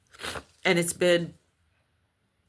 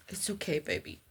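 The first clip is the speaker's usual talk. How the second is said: soothing the dog; 12 dB quieter than usual. Real, not acted. Soothing her dog aside.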